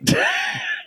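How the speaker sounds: squeaky burst of laughter; high-pitched giggle. Real, not acted. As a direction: let out a shrill giggle. Laughs at own joke.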